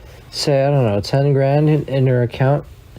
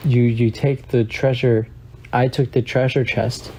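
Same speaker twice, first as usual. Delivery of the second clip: normal talking voice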